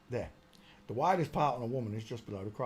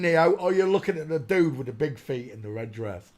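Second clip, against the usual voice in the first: loud, high, teasing; loud mocking; 5 semitones higher, 11 dB louder than usual. Real, not acted. Mocking the dancer's feet to viewers, loud teasing. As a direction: shout the mockery gleefully.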